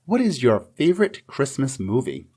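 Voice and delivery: ordinary talk, nothing marked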